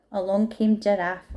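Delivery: plain reading voice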